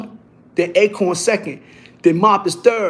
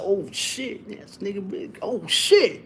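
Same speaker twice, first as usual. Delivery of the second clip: hushed oh shit; 8 dB quieter, slower than usual. Retold or imitated. Quiet reaction to the bigger guy.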